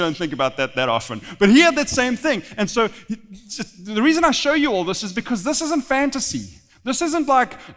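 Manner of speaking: ordinary delivery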